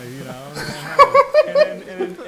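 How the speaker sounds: breaking into laughter mid-story; laughing helplessly while telling it. Real, not acted. Telling friends the stripper story, laughing hard. Laughing through retold awkwardness.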